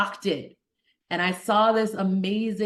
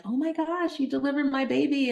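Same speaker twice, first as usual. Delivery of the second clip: high, excited mock gush; gushing patient; 7 semitones higher than usual. Patient recognizing her doctor, gushing high. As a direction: gush like a thrilled former patient.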